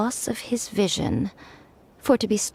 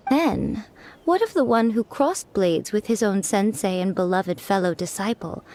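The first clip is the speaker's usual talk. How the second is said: ordinary delivery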